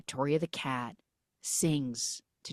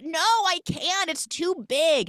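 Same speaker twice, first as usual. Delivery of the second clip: high, loud, drawn-out panicky protest; hammed-up frightened refusal; mock scared; 13 semitones higher, 5 dB louder, slower than usual. Magic cat is asked to do magic, mock panicked protest. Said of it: "he's so scared".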